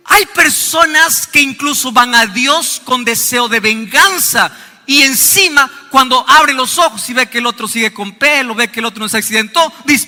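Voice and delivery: usual speaking voice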